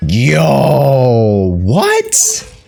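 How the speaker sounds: what shoots up very high in disbelief; stunned, pitch leaping on what; amazed what. Real, not acted. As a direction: gamer stunned by a character's stats. Amazed by character stats.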